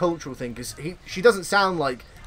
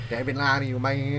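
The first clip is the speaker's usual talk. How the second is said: Andy's impression; 4 semitones lower than usual. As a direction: imitate a bad Bray Wyatt impression. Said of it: "I just had Andy's voice".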